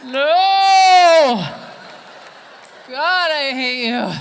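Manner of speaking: very loud, high anguished yell; drawn-out exasperated outburst; fed-up heckler. Acted. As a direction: bellow it like an audience member at breaking point. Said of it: "cannot handle this for a second longer".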